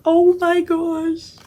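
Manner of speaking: drawn-out thrilled oh my gosh; ecstatic. Real, not acted. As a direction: squeal over something lovely. Gushing over matching bag.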